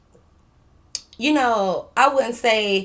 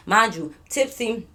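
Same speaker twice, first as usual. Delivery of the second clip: normal talking voice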